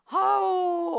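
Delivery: a high, loud sigh of relief ending the ordeal; relieved exclamation. Real, not acted. Preacher exhales relief to the congregation, high loud "아우". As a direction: let the strain out in one high cry. Relief after long prayer.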